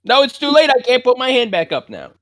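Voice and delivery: high, frantic exasperation; exasperated protest. Real, not acted. Heated mix-up over missed vote.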